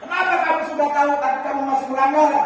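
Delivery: loud, high, very fast scolding; punisher teacher scolding. Shown as a demonstration. Teacher berates a pupil who broke rules, loud and fast. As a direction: rattle off the accusation. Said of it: "nada suara tinggi".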